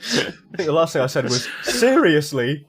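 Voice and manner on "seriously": holding back a laugh. Retold or imitated. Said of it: "whilst trying not to laugh".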